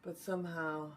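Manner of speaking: venting frustration, paced with long pauses; tapping script. Acted. Leads tapping round phrases.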